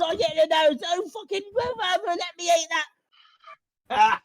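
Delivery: high, squeaky rat fighting over scraps; greedy squeaky rat. Acted. Rats squabbling over food.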